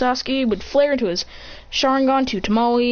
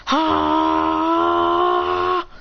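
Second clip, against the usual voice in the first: high, long held cry; drawn-out attack chant; 8 semitones higher than usual. Acted. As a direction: hold the syllable high and steady.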